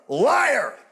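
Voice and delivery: high, drawn-out mock accusation; hammed-up indignant cry; accusing yell. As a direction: call out the lie in comic outrage. Acted accusation of wife.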